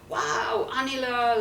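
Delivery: drawn-out, mock-astonished wow; imitating surprised visitor. Young Tibetan greets a nun at the door, acting surprised. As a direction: act out his surprise at seeing her. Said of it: "very surprised that he's seeing a nun".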